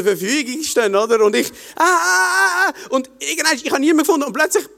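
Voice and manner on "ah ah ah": panicked gasps. Retold or imitated. Panic as groups form.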